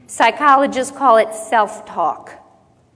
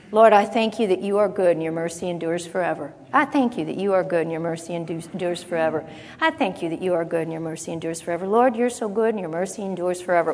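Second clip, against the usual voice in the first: low, soft murmured thanks, repeated to himself; quiet repeated confession; 5 semitones lower, 6 dB quieter, faster than usual. Shown as a demonstration. Believer murmuring thanks to God while doing chores. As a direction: murmur the praise over and over under your breath. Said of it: "walked around your house going".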